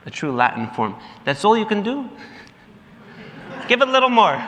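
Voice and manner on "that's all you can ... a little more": higher, needling challenge; teasing push. Retold or imitated. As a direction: goad him to give more. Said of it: "Rosie turns to the fellow and says".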